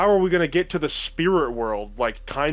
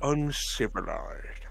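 mock uncivilized; 6 dB quieter, much slower than usual. Quoting a famous line.

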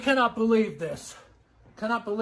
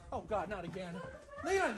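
ordinary talk, nothing marked

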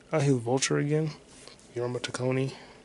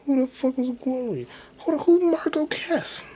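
exasperated; 12 semitones higher than usual. Real, not acted. Confused outburst while searching.

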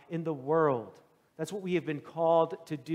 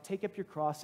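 softer, level, gentle reply; 7 dB quieter than usual. Acted. Jesus answers the prayer.

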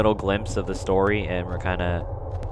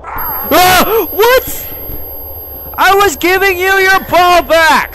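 very high, loud shriek of protest; terrified screaming; 8 dB louder, much slower than usual. Real, not acted. Player attacked while handing back the ball, shrieking protest. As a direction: scream in shock and outrage.

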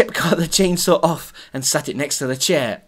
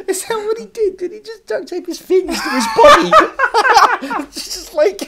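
high squeals of laughter; laughing; 9 dB louder than usual. Real, not acted. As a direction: laugh through the words. Laughing at co-host's joke.

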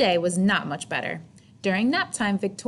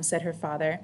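plain reading voice

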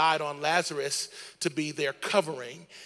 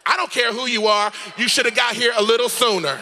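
indignant, higher-pitched sister telling someone off; sassy attitude; 5 semitones higher, 5 dB louder than usual. Acted. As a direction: play an offended sister with loads of sass. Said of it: "She had an attitude".

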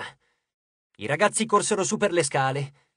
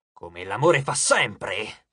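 low, very slow, intense god; ominous deity, drawn-out and deep; deep menacing god; 9 semitones lower, much slower than usual. A narrator's reading. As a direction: intone each word low and slowly. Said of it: "Era profonda e intensa".

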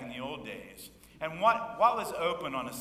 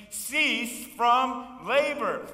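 emphatic definition; 9 semitones higher, 10 dB louder, much slower than usual. Acted. Preacher defining rest.

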